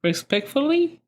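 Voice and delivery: giggle. Real, not acted.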